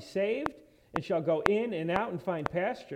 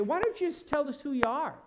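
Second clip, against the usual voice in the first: impatient questioning; 6 semitones higher than usual. Retold or imitated. People challenging Jesus, acted.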